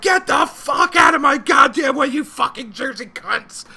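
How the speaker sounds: slow furious yell at other drivers; road rage yell. Retold or imitated. Driver yelling at Jersey traffic, slow furious shout. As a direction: bellow it out the window at traffic. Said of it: "I'm yelling and screaming at people".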